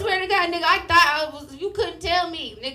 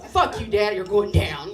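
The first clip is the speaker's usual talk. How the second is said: mock outrage; 4 semitones lower than usual. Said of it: "I'm fucking outraged".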